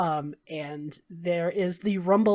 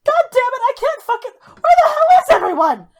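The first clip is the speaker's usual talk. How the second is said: much higher and louder, raging; furious distant yell; 9 dB louder than usual. Acted. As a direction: bellow it across the square. Slaver shouting from afar.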